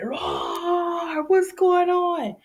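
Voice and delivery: high, drawn-out roar into an angry demand; mad lion roar. Acted. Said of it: "The lion's mad".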